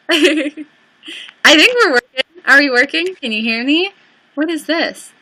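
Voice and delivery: ordinary delivery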